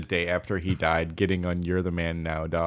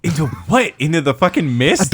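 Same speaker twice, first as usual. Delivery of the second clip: loud, raised-pitch disbelief; incredulous; 6 semitones higher, 6 dB louder than usual. Real, not acted. Asking a friend where the jumper would vanish, incredulous. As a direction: challenge it as if it's absurd.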